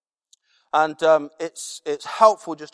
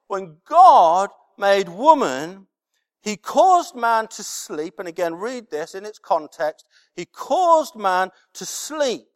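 slow solemn telling; 2 semitones higher, 4 dB louder than usual. Acted.

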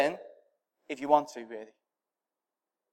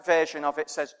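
normal talking voice

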